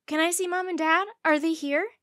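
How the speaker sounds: high, loud eager blurt. A narrator's reading. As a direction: burst out with it, can't wait. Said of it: "Mandy blurted out".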